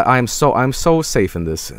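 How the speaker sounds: normal talking voice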